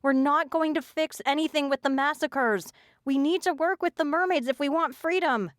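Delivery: high, desperate shouting; pleading shout. A narrator's reading. Dragged-off girl shouts her plea at her captors. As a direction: shout the truth at them, no pretense left. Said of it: "I yelled, abandoning pretense".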